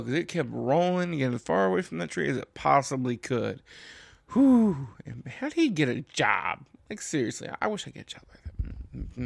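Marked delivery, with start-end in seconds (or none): 4.3-4.9 high, loud whew; exasperated whew. Real, not acted. After the apple rolling from the tree, exasperated whew. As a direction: let out a big rising-energy whew.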